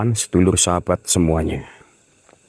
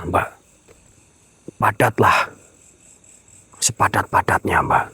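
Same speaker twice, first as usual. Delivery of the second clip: ordinary delivery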